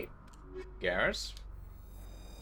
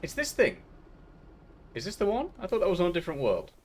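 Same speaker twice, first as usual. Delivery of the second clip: excited; 7 semitones higher than usual. Real, not acted. Excited find in game.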